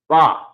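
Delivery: "Ba" as one open syllable; syllable demonstration. Shown as a demonstration. Teacher showing students Bayat's first syllable, "Ba" sounded out alone. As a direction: sound out "Ba" on its own after a break. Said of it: "The first syllable of Bayat".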